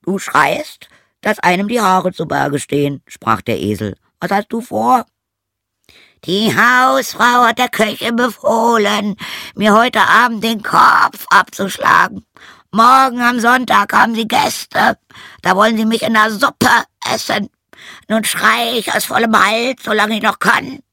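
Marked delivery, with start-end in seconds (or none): 0.0-3.0 high, loud donkey voice; donkey character. Acted. Donkey remarks on the rooster's screaming, high donkey voice. 4.2-5.1 high, loud donkey voice; donkey character. Acted. Donkey remarks on the rooster's screaming, high donkey voice. 6.3-20.8 very high, loud crowing voice; rooster shouting full force. A narrator's reading. Rooster tells of his coming death, full-force shrieking rooster. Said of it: "schrie aus Leibeskräften".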